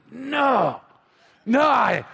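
mild retold outburst, pitch jumping up; drawn-out, frustrated no; exasperated refusal. Fed-up dad silently refusing his son, exasperated mock outburst. Father inwardly refuses son's request.

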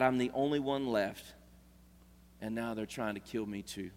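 the same complaint, now spoken in peace; quiet, calmed Elijah after meeting God. Acted. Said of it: "a different emotion".

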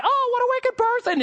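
high shocked cry, hammed up; mock horror. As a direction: recoil in comic disgust. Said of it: "you hear them running away".

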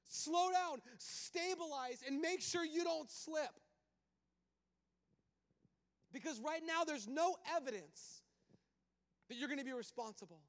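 God slowing Moses down before he slips; God warning. Acted.

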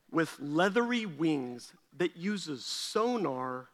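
slow wonder. Retold or imitated. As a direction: savor every word in awe. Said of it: "And I go".

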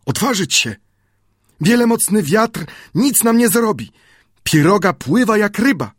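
put-on high, enthusiastic native boy; high and full of zeal, dreaming aloud; eager Friday. A narrator's reading. Friday voiced by narrator. (z zapałem, głosem Piętaszka)

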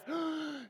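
sudden high intake of breath; short high-pitched revival gasp; sharp high gasp. Retold or imitated. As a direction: gasp like first breath back from death. Lazarus takes first breath.

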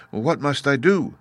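put-on high child's voice; questioning little girl. A narrator's reading. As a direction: ask it like an anxious small girl. Little girl asks the Head.

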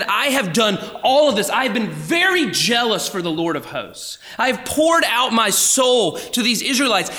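high, forceful cry of frustration, acted; impassioned complaint. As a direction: pour out the prophet's exasperation, punching key words. Voices Elijah's bitter complaint.

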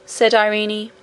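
ordinary delivery

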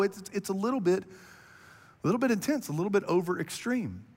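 usual speaking voice

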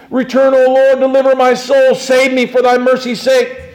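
high, loud urgent prayer; crying out. Acted. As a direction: cry it out to God as David. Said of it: "David is crying out".

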